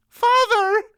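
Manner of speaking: very high, loud wail. A narrator's reading. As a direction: wail it at your father. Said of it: "Anhelda wailed".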